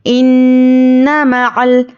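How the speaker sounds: इन्ना मअल slow, the doubled न held through the nose; recitation with ghunna. Shown as a demonstration. Teacher demonstrates ghunna to students, slow nasal chant. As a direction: hum the न of इन्ना long and nasal.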